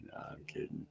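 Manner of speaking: whispered aside; breathy whispered take-back; whispering. Real, not acted. Teasing, then takes it back.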